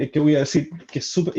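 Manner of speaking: usual speaking voice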